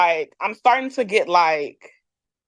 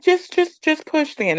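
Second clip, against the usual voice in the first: lightly voiced higher, halting midwife; imitated detached midwife; 7 semitones higher than usual. Midwife from her chair to labouring mother, imitated offhand. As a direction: mimic an offhand instruction to push.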